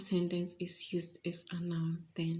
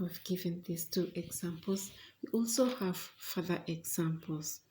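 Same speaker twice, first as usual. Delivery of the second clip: ordinary delivery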